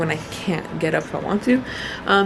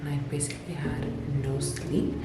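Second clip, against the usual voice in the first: quiet; 4 semitones lower, 8 dB quieter than usual. Real, not acted. Quiet during strong contractions.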